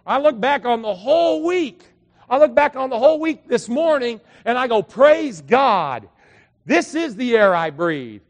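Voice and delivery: loud, high, fervent preaching; preaching at full force. Acted. Pastor to the congregation on Sunday, loud fervent preaching. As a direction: build the praise to the rafters.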